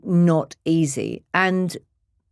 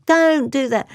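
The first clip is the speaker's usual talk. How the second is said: high, urgent plea; imitated alarmed objection; retold protest; 9 semitones higher than usual. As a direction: stop them, pitched right up. Retold daughter pleading with her mother.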